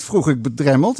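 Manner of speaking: ordinary delivery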